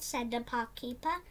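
ordinary delivery

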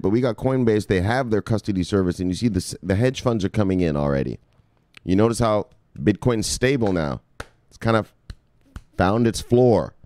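normal talking voice

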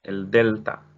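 ordinary talk

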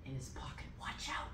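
sinister whispered warning; hushed, insinuating tempter's whisper; whispering enemy. Retold or imitated. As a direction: whisper a warning into someone's ear. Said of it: "the enemy's like".

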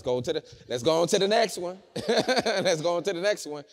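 laughing brush-off. Retold or imitated. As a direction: toss it off loudly, laughing. Mimics someone shrugging off a breakup.

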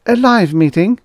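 high, loud shocked question; gasped. A narrator's reading. Assistant shocked by his boss's order, gasping. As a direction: gasp it in disbelief, rising. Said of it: "Frick gasped".

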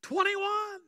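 high, very loud yell; loud, high-pitched holler; new prisoner shouting. Acted. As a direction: shout it out to join in the joke. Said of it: "So he shouted".

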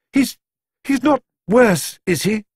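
fearful, halting question; anxious. A narrator's reading. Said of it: "asked Missus Brown".

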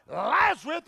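calling out "Lazarus" at full voice; loud cry. Acted. Jesus calling Lazarus from the tomb, loud cry. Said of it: "he cried with a loud voice".

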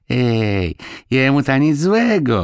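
plain reading voice

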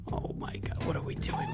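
hushed, startled whisper. Real, not acted. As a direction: breathe it out in shock. Whispers in surprise.

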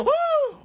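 very high, loud "uh!"; short squeal of comic shock; shocked whoop. Real, not acted. Reacting to the surgery.

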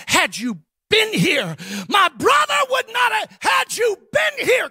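building from firm to a loud, high shout; grieving sister yelling at Jesus; accusing outburst. Retold or imitated. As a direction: hurl the blame at him. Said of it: "she had to say".

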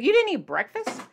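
can't believe what she's hearing; wife to husband at lunch, high disbelieving question; 1 shocked question. Retold or imitated. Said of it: "I was like".

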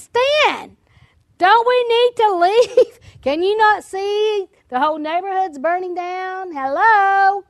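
retold alarm, played up for comic effect; exasperated urgent plea. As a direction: plead in disbelief with someone who isn't reacting. Retold urgent plea to husband in fire.

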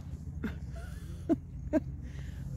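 breathy high nervous giggle; nervous laugh. Real, not acted. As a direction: laugh through the fear. Laughs after saying she's petrified.

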